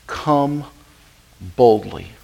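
slow, firm, spaced-out order; command. Retold or imitated. God commanding the believer to come, slow and firm. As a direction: lay it down as a command. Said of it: "No, he commands it".

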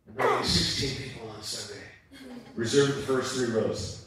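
low, curt tough-guy voice; lightly voiced gruff visitor giving orders; imitating Glenn. Glenn phoning the pastor to reserve rows, low and curt. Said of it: "this is exactly how he does it".

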